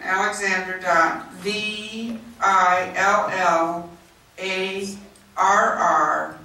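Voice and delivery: slow, emphatic letter-by-letter spelling; spelling out slowly. Real, not acted. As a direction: dictate each letter slowly, pausing between groups. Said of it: "If I'm talking that slowly".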